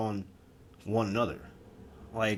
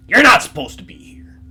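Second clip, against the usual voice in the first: menacing demon; 5 semitones higher, 11 dB louder than usual. Retold or imitated. Demon warns the human intruder.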